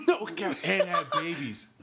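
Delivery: high, loud, laughing exclamation; laughing disbelief. Real, not acted. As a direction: crack up while stretching the words. Laughing over the dragon romance.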